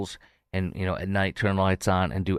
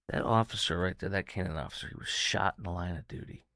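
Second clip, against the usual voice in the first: ordinary talk, nothing marked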